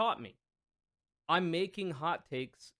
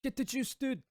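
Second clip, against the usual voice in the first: xQc voice; 11 semitones higher than usual. Retold or imitated. Continuing the xQc bit.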